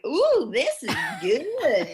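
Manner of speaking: loud, high, drawn-out excited reaction; excited hometown accent. Retold or imitated. Guest retelling her first reaction to the wine, excited. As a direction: gush it slowly with hometown twang. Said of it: "I got so excited that my hometown came out".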